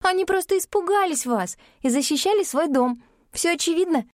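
put-on a child calmly explaining; young girl voice. A narrator's reading. Alexa's voice, higher and set apart.

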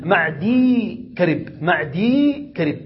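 معدي كرب said slowly, syllable by syllable; slow model example. Shown as a demonstration. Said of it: "يبنى على السكون". (نطق بطيء واضح لمعدي كرب)

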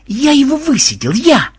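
high, loud possessive outburst; yelling. A narrator's reading. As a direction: shout your claim defiantly. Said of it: "вдруг заорал Ксенобайт". (запальчивый высокий крик)

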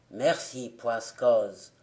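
a dead man letting go of a grudge; forgiving ghost. Acted. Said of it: "prononça le spectre".

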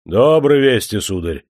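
loud opening, dropping at the end; booming bass courier. A narrator's reading. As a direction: boom out the good news. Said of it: "загрохотал басом курьер". (громыхающий бас курьера)

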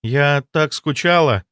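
plain reading voice

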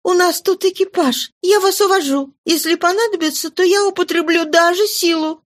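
put-on high girlish voice, teasing; Cosette's playful threat. A narrator's reading. Cosette teases the old man she'll take him by force. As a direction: laughingly insist on carrying him off.

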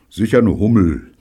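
loud, grumpy dismissal. A narrator's reading. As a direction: brush it off grumpily, raising the voice. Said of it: "meinte David mürrisch". (mürrisch und laut)

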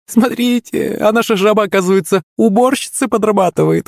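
put-on very high-pitched taunting girl; snorting mockery. A narrator's reading. Chubby girl mocking Yulenka before her friends, snorting. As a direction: squeal the jibe in a silly high voice. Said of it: "хрюкнула пухлая девочка".

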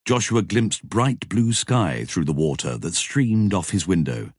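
ordinary delivery, nothing marked

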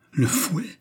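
high-pitched, appalled exclamation; horrified howl. A narrator's reading. Prisoner hears she faces whipping, howling. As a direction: cry out in shock, pitch leaping up. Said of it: "hurla Jeanne".

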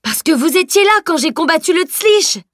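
high voice with a note of anguish; anxious insistence. A narrator's reading. Boaster to his only witness, anxious insistence. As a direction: urge her anxiously to remember. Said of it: "Il y avait une nuance d'angoisse dans la voix".

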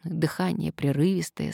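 plain reading voice

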